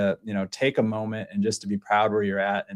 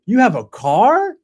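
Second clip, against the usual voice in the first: put-on stunned kid; 11 semitones higher, 7 dB louder, slower than usual. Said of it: "He's like".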